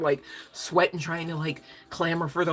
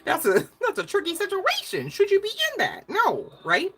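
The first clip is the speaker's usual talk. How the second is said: much higher, louder, giddy; giddy excitement; 13 semitones higher, 5 dB louder than usual. Real, not acted. Delighted while greeting a viewer by name. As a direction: bubble over with delight.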